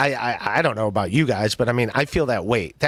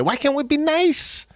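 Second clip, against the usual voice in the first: high-pitched pleading whine; whiny plea; 12 semitones higher than usual. Pretend. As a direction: beg in a squeaky voice.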